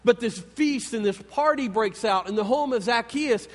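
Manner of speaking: ordinary delivery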